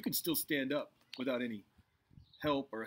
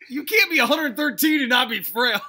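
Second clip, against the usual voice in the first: louder and higher, protesting; indignant shout; 10 semitones higher, 15 dB louder than usual. Acted. Indignant old man in improv.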